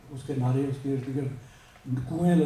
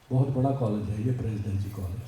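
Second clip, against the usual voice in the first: usual speaking voice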